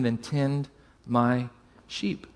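slow, soft and gentle; tender reassurance. Retold or imitated. Jesus gently commissioning Peter, soft and slow. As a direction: say it like a quiet blessing.

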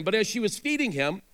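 ordinary talk, nothing marked

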